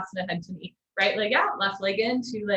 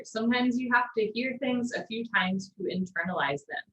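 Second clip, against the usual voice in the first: ordinary talk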